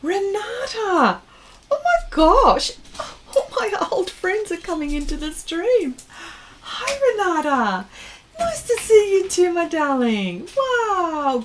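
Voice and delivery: delighted greeting. Real, not acted. Greets old friend joining stream.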